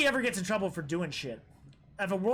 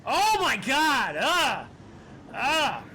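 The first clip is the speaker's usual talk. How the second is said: slow, loud, high disgusted oh my God; real shudder at the spider, trailing off; creeped-out reaction; 5 semitones higher, 4 dB louder, much slower than usual. Real, not acted. Streamer reacting to a creepy spider, loud creeped-out exclamation.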